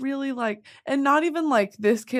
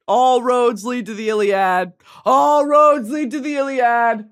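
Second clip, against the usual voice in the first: loud declaration; 3 semitones higher, 8 dB louder than usual. Pretend. Streamer answering why it's the Iliad, loud declaration. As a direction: belt it out like a rallying cry. Belts out a catchphrase.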